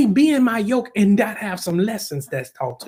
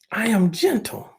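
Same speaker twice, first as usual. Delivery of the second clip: usual speaking voice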